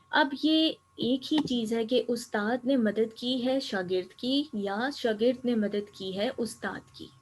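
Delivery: normal talking voice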